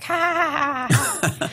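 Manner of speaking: high, giddy mock laugh; giddy laughter. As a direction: act out a string of giddy ha-ha-has. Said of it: "that just made me giddy".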